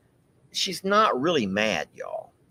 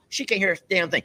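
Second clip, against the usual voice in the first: normal talking voice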